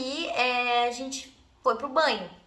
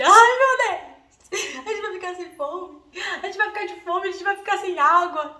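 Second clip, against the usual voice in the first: comic hammed-up despair; 7 semitones higher than usual. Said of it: "eu sempre fui muito cômica".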